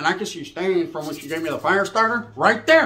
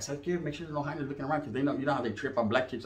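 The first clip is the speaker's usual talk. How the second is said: ordinary delivery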